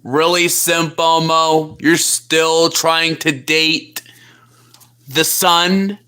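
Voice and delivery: low mocking buddy voice; deep-voiced plushie friend. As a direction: tease him dryly from way down low. Second plushie mocks the suitor.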